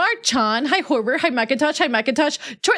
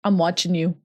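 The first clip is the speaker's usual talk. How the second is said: creepy low voice; 6 semitones lower than usual. Pretend. Creepy lowered voice.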